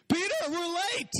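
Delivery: hurried, panicked Jesus running late; stressed Jesus. Retold or imitated. Jesus panicking at Peter about being late, frantic and high.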